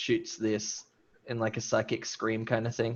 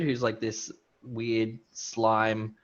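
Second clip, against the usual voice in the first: ordinary talk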